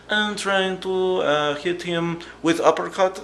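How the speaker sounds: high, slow, dopey fighter voice; imitating the fighter. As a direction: whine it slowly like a clueless fighter. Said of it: "He's like".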